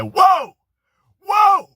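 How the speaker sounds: high, loud whoa whoa; mock shout. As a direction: yelp it out like Bruce backing off. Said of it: "he goes back and said".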